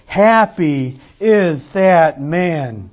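normal talking voice

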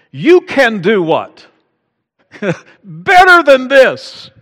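high, loud, slow mother's scold; loud mock mother, laughing through it; mock scolding mom. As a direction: play mom demanding better. Mom scolding son over report card.

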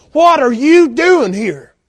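loud, slow, high-pitched confrontation; wake-up call. Acted. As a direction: call the strayed one out, stretching each word. God's wake-up call to strayed believers.

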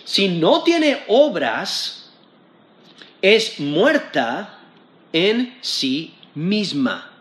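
very slow, weighty verse read aloud; solemn slow scripture reading. Acted. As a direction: let each phrase land with solemn pauses. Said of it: "Porque dice".

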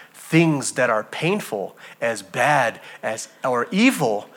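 ordinary talk

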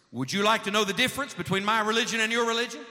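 high, loud, pointed question; assertive. Retold or imitated. Iranian dentist challenging the preacher, assertive and loud. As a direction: put the question to him with force. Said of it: "with real assertiveness".

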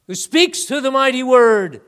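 preaching climax. Real, not acted. Preacher exalts the mighty word to congregation, loud and high. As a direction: lift it up to the rafters.

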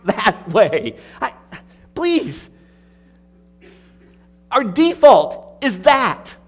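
loud, high, urgent pulpit voice; fervent preaching. Acted. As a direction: press the appeal home from the pulpit. Preacher presses the congregation.

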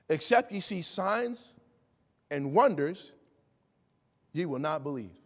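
deliberate scripture quote with long pauses; solemn quotation. Retold or imitated. Preacher quotes Jesus's response to the nobleman, solemn and measured.

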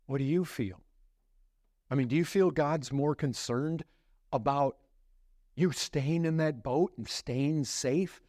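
ordinary delivery

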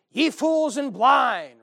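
loud, slow, high preaching shout; full shout. Shown as a demonstration. Jesus rebuking the Pharisees, a loud slow shout. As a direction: bellow it at the crowd. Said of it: "Probably said".

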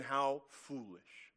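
slow, quiet scoff; drawn-out, understated derision. Retold or imitated. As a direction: say it slowly and softly, dismissing them. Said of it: "scoffs at them. He mocks them in derision".

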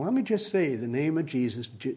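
ordinary talk, nothing marked

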